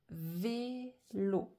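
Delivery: VÉLO cut into vé, lo; syllable by syllable. Shown as a demonstration. Teacher showing vélo has two syllables, syllable by syllable. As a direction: pause between the syllables of vélo. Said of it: "j'ai deux syllabes".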